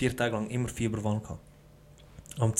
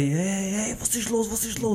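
high-pitched panicked cry, not full volume; retold frantic shouting, pitched way up; mock scream; 8 semitones higher, slower than usual. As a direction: act out yelling in a fever delirium. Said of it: "ich, ich schrei".

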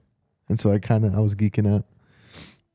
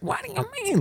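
much higher, softer cartoon duck squawk; retelling the duck's reaction, in a high duck voice; Donald Duck voice; 7 dB quieter than usual. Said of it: "I don't know how to do the voice".

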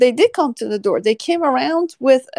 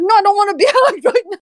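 fast, higher retort broken by laughter; laughing refusal; 8 semitones higher, 3 dB louder, faster than usual. Retold or imitated. Officer retelling refusing the man's hug, laughing. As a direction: refuse quickly while cracking up.

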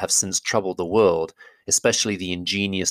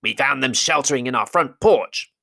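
goblin driver voice; 6 semitones higher than usual. Acted. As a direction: bark it like an eager goblin.

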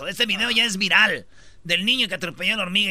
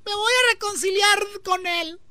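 much higher put-on girl voice; imitating the girlfriend; 11 semitones higher than usual. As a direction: play the girlfriend, sweetly set on it. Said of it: "Y ella dijo". (imitando a la novia con voz aguda)

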